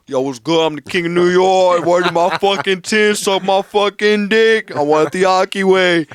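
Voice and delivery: loud, slow, brash New York swagger; exaggerated New York tough-talk; mock New York accent. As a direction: overplay a New Yorker boasting on the phone. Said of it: "that's not how we talk".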